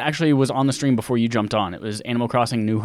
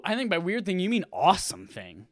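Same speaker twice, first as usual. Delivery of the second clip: ordinary delivery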